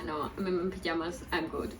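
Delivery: ordinary delivery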